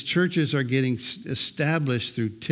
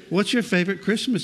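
higher, quicker little-kid imitation; imitated two-year-old; 7 semitones higher, faster than usual. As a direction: lightly mimic a toddler's eager question. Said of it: "She's two".

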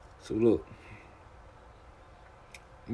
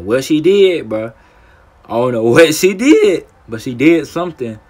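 laughing loudly; 6 semitones higher, 13 dB louder, slower than usual. Real, not acted. Loud laughing emphasis.

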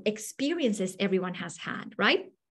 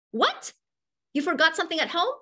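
raised-pitch, indignant parental scolding; mock screaming dad; 6 semitones higher, 4 dB louder, faster than usual. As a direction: scold your kid in a hammed-up rant. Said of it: "and he started screaming".

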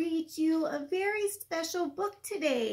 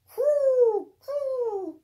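pigeon coo; 7 semitones higher, 6 dB louder than usual. Acted. Teacher cooing as pigeons for a child, lightly.

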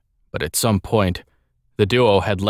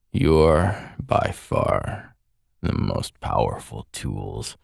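low, soft dying tyrant; cold rasping evil emperor; 7 semitones lower, 3 dB quieter, slower than usual. Acted. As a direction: sneer it out as a fallen villain.

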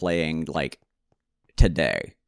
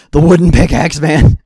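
much louder, higher burst of frustration; exasperated outburst; 8 semitones higher, 14 dB louder than usual. Real, not acted. Player venting about the worn-out wooden pickaxe, exasperated yell. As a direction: yell it out, fed up. Venting relief after tool breaks.

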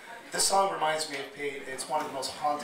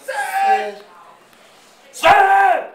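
much higher, louder yell across the kitchen, building; yelling a name; 15 dB louder than usual. Retold or imitated. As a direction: bellow his name so he hears. Calling cook to the counter.

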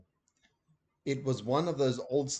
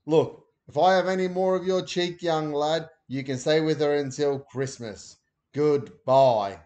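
louder, cross fatherly warning; stern Dad; 3 semitones higher, 8 dB louder than usual. A narrator's reading. Dad warning cheeky Ben before hanging up. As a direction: tell the lad off sharply.

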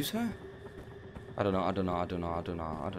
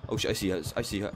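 normal talking voice

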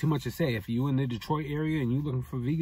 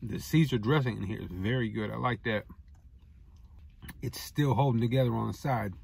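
speaking between bites; talking while chewing; 4 semitones lower than usual. Real, not acted. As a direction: mouth partly full of food. Seen 0: Bites wrap, then talks with a moving, partly full mouth.

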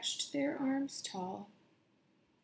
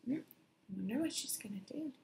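curious aside; 7 dB quieter than usual. Acted. Reader's suspense aside to listeners.

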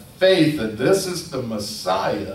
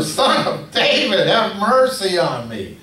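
high, loud desperate yell; yelling louder; 9 semitones higher, 6 dB louder than usual. Retold or imitated. Beggar yelling louder over the crowd to Jesus, desperate plea. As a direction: outshout the crowd, begging. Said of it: "caused him to yell louder".

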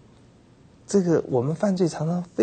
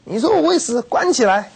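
very high, loud outraged order; angry king; 12 semitones higher, 6 dB louder than usual. Retold or imitated. Ahab enraged at the prophet of death, loud shout. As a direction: explode and order him locked up. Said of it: "亚哈听了又生气了".

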